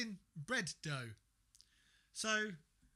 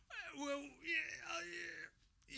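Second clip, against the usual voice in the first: stumped tradesman; 9 semitones higher, 6 dB quieter, much slower than usual. Retold or imitated. Mocked tradesman stumped for answer.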